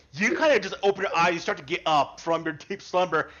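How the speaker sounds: usual speaking voice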